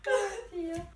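high, loud laughing hallelujah; laughing cheer. Real, not acted. Celebrating correct guess. (esultanza ridendo)

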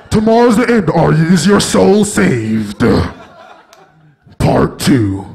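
deep doomsday preacher. Retold or imitated. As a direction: boom it like a street preacher. Mocking preacher watchtower voice.

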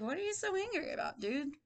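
high, quick mock question; puzzled onlookers. Teacher imitates people eyeing angry man, high and puzzled. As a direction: imitate baffled bystanders. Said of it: "These people are like".

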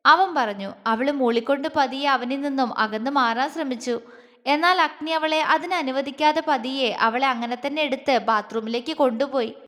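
ordinary delivery

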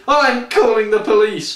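spat threat. A narrator's reading. The woman threatens the old man, spat high and loud. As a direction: fire it back at him. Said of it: "the woman spit".

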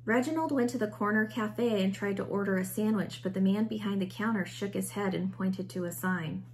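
usual speaking voice

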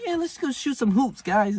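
high, light mocking tone; mock girly casual voice. Mocking casual hoopers, high light mock voice. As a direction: make them sound harmless.

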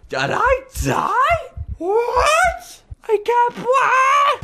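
high, shouted disbelief at dying; can't believe it's game over; disbelieving shriek. Real, not acted.

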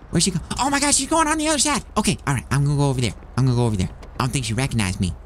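normal talking voice

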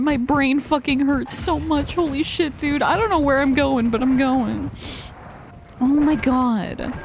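ordinary talk

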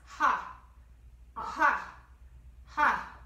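sharp panted ha bursts, loud; short ha's. Shown as a demonstration. Teacher shows short fire breaths, loud panting ha's. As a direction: pump out quick breathy ha's. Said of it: "make short ha's".

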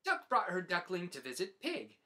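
usual speaking voice

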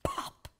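squeaky balloon pop; popping balloon sound. A narrator's reading. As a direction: snap out the pop. Balloon bursts.

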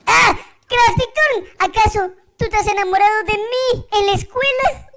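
bright, high and eager, with rushed questions; a nervous, excitable young character; high-pitched character voice. Acted.

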